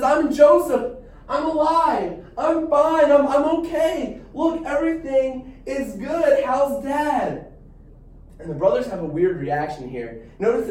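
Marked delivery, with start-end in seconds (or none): 0.0-7.5 higher and louder, bursting out with relief; a brother blurting out he's alive and fine, almost laughing; excited reveal. Retold or imitated. Joseph reveals himself, retold excitedly.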